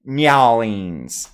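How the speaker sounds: loud drawled "Nyowlings" for New Orleans; mock game-character pronunciation of "Nyowlings"; Gabriel Knight. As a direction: say "Nyowlings" as NYAH-leens, punched. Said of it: "how Gabriel Knight pronounces".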